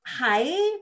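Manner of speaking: put-on high, hair-twirling Valley girl hi; mock ditzy hi. Shown as a demonstration. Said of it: "going back to the hair twirling".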